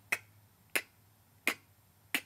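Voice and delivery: "k" with no puff of air; Arabic-accented K. Shown as a demonstration. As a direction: hold the breath back on "k". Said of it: "say the K with an accent".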